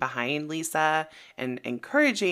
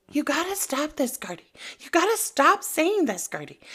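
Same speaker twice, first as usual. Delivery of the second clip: higher, mechanical repeated loop; robotic repeating; 10 semitones higher than usual. Retold or imitated. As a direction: parrot it like a little robot. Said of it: "like the little robot she is, starts repeating".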